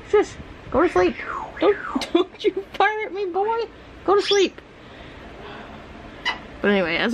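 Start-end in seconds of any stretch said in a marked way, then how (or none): 0.1-4.6 high sing-song pet talk, laughing. Real, not acted. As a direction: coo at the pet to sleep.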